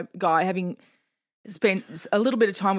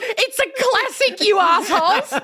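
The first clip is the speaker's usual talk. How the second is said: high, loud hammed-up indignation with laughter; mock tearful outrage; 14 semitones higher, 5 dB louder than usual. Dad defending Anchorman to his kids, mock tearful outrage. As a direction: play the wounded, furious dad, laughing. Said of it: "he got so angry, he cried".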